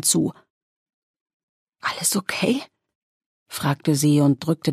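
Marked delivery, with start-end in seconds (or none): 1.8-2.6 breathy whispered question, higher; voiceless whisper. A narrator's reading. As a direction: mouth it with almost no voice. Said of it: "kam kein Ton aus ihrem Mund".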